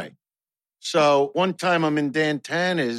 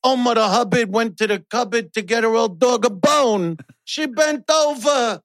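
recited dirty nursery rhyme; 6 semitones higher than usual. Pretend. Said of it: "we gotta do the third".

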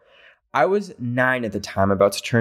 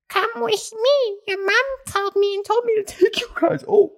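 put-on creepy old woman. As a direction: lure the kids away in a creepy high voice. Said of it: "she goes and says".

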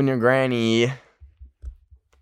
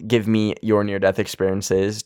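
ordinary talk

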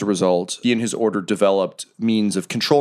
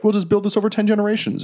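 mock ancient worker; 13 semitones higher, faster than usual.

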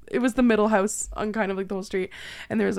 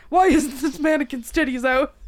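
imitating dad; 6 semitones higher, 6 dB louder than usual. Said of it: "I'll never forget Dad".

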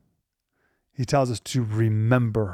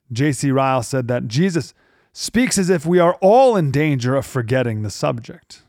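ordinary talk

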